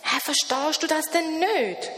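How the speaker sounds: high, odd creature calling out. A narrator's reading. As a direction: call out to him, puzzled. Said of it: "ruft das etwas". (rufend, hohe Wesenstimme)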